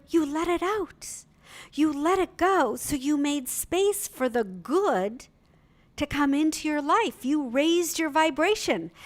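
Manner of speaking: mother excitedly explains to daughter. Retold or imitated. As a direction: lift her up with joy. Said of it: "I said".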